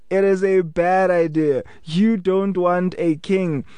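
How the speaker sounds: ordinary talk, nothing marked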